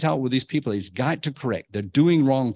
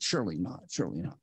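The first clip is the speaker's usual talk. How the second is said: softer each time, dying away to near silence; repeated surely not trailing off much softer; fading to a hush; 6 semitones lower, 9 dB quieter, faster than usual. Shown as a demonstration. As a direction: let the doubt fade out into nothing. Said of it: "And we say".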